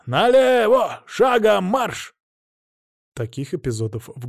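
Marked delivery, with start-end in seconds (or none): 0.1-2.1 high, slow barked drill command; shouting drill sergeant. A narrator's reading. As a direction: bark marching orders at troops.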